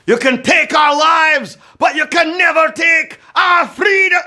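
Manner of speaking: hammed-up Scottish accent, shouted high and loud. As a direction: roar it like a Scottish rebel in a bad Scottish accent. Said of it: "I was gonna try a Scottish accent".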